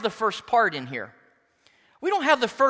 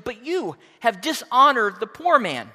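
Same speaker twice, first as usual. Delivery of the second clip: louder, emphatic "But you have dishonored the poor man"; 3 semitones higher, 5 dB louder than usual. Retold or imitated. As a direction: drive "poor" home with force. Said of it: "emphatically he goes".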